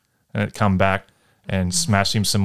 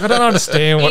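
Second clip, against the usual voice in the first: mock whine; 11 semitones higher, 6 dB louder than usual. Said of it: "he's like".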